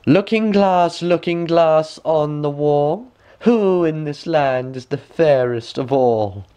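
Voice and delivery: high, slow regal chant to the mirror; queen. A narrator's reading. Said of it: "went in front of the looking-glass and said".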